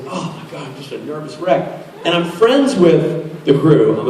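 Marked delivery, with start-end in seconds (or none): 0.1-1.9 a nervous aside to yourself, much softer; nervous muttering. Retold or imitated. Actor muttering to himself on set, softer and rattled. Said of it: "And I was like".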